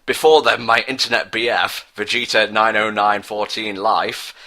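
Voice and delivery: plain reading voice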